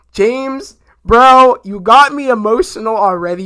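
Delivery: loud, high call to James; excited shout-out. Real, not acted. Fan calling out to James Gunn, loud and high. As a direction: shout it at him, overwhelmed. Fan addressing director, worked up.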